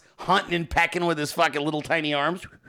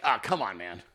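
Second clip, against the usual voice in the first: tag-less weary protest; 8 semitones lower, 6 dB quieter than usual. Retold or imitated. Weary reaction to Hogman's memes.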